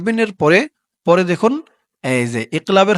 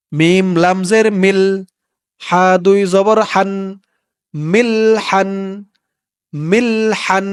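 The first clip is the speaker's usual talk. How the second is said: drawn-out recited syllables; slow recitation; 2 semitones higher, much slower than usual. Shown as a demonstration. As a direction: lengthen each syllable, recitation tune. (ধীরে টেনে পড়া)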